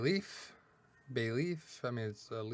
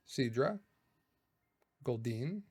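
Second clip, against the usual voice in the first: ordinary talk, nothing marked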